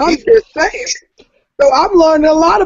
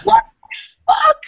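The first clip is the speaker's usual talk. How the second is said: high, startled retold outburst; shocked disbelief. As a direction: blurt it out in stunned disbelief, pitched way up. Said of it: "I went".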